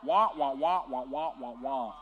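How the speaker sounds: loud muted-trumpet wah wah; loud cartoon wah-wah drone; Charlie Brown adult sound. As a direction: imitate the trombone teacher voice from Charlie Brown. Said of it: "what sound do the adults make".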